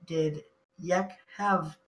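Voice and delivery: ordinary talk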